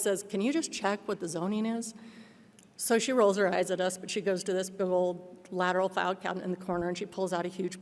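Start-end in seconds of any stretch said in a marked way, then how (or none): none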